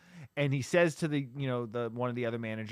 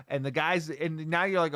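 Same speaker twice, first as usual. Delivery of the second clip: usual speaking voice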